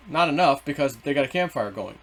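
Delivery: normal talking voice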